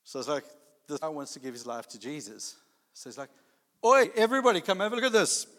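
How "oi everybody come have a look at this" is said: high, loud shout across a party; calling out. Retold or imitated. Party guy calling everybody in to look, loud shout. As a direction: holler to gather the whole room. Friend calls the whole party in.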